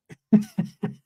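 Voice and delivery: high real laugh; short, genuine fit of laughter; amused laugh. Real, not acted. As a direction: break into a brief high chuckle. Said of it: "I'm just laughing".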